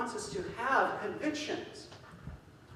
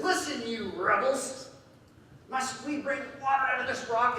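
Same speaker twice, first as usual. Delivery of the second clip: provoked rebuke; 6 semitones higher, 3 dB louder than usual. Retold or imitated. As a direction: scold the rebels like you're fed up. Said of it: "He's still provoked at these people".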